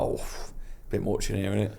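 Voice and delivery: soft, voiceless muttered complaint; muttered aside. Retold or imitated. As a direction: grumble it under your breath. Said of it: "I'm like".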